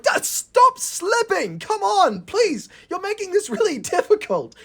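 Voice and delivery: very high, slow, whiny pleading; a fed-up little engine begging; exasperated Thomas. Acted.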